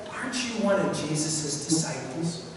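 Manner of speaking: soft, hushed questioning; a hint of a little girl, spoken softly; lightly voiced little girl. Little girl asking Peter by the fire, softly. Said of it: "a little girl walks up to Peter and says".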